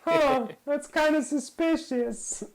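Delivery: very high, slow, suspicious voice; suspicious squeak. Retold or imitated. A doubter teasing Snoke, high suspicious drawl. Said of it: "You sound a bit like Palpatine".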